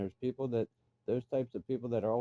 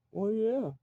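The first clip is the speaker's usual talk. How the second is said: much higher put-on voice; mock sheepish answer; 12 semitones higher than usual. Mocking the driver who just broke down crying, high sheepish voice. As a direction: sound dopey and caught out.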